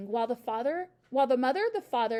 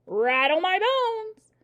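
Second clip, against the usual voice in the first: very slow, loud, high ghostly wail; spooky parrot; 8 semitones higher, 9 dB louder, much slower than usual. A narrator's reading. Parrot repeating its party trick, spooky wail. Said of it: "imitation of a spooky voice".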